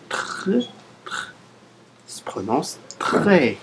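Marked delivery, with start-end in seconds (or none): 0.1-1.3 "tr" whispered, just the bare consonants; whispered consonant sound. Shown as a demonstration. Teacher to learners isolating a sound: whispered "tr". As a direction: breathe out "tr" with no voice. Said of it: "avec les consonnes T R".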